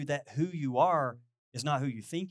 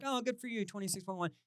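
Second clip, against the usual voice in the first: much higher, fading mock cheer; a skeptic mocking a runner's bumper sticker; sarcastic praise; 6 semitones higher, 4 dB quieter than usual. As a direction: sneer it sweetly, dropping off.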